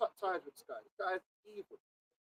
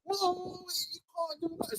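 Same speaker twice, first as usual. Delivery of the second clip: higher-pitched pretend alarm; mock objection; 7 semitones higher than usual. Plays the critic stopping their party, mock objection. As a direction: cut in like a scandalised objector, in mockery.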